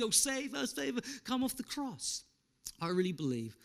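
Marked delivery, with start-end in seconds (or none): 0.0-2.2 higher, softer jeer; sneering plea from the criminal; a hint of mockery; mocking criminal. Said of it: "started to say similar things".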